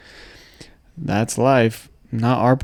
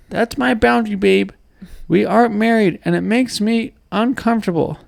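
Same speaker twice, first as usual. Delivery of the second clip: cartoon character voice; 9 semitones higher than usual. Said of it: "like a cartoon character".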